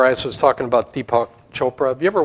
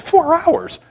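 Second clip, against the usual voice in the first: four hours, pitched much higher and louder in disbelief; incredulous; 13 semitones higher, 7 dB louder than usual. Real, not acted. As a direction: exclaim it as if you still can't believe it. Incredulous repeat of download time.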